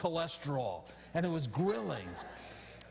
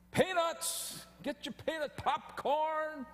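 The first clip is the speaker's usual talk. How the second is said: stadium vendor hawking; 10 semitones higher, slower than usual. Retold or imitated. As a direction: call it out like a hot dog vendor. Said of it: "these guys are going up and down the aisles".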